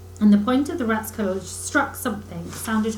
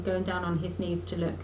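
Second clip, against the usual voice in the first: ordinary delivery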